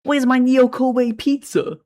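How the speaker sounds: put-on high, loud, slow Northeast accent; hammed-up Northeast accent, drawn out and loud. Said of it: "I can kind of do a Northeast accent".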